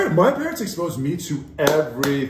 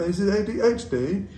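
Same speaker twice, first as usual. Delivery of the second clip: high mock British accent; 8 semitones higher than usual. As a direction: imitate someone slipping into British. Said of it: "Did I have a British accent?".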